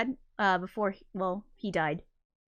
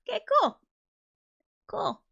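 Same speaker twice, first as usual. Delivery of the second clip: nervous laugh; 13 semitones higher, faster than usual. Real, not acted. Laughing after grim fact.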